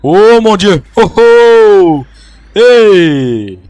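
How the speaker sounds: loud, high frightened cry; startled scream. Real, not acted. Gamer screaming at a jumpscare, loud and high. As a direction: scream at the sudden scare.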